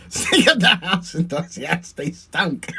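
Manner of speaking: words breaking up into real laughter; laughing hard. Real, not acted. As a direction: laugh your way through the memory, losing voice. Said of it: "laughing my ass off".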